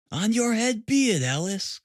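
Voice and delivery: mother's bitter cry at Alice. A narrator's reading. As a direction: throw the blame on her daughter. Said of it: "cried her mother".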